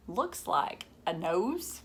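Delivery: plain reading voice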